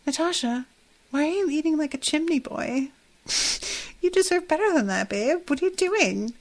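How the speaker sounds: host playfully scolding herself over junk food in a soft, high Nadja voice; self-scolding as Nadja. Pretend. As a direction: tease yourself like a vampire chiding her baby. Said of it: "I'm gonna tell myself that".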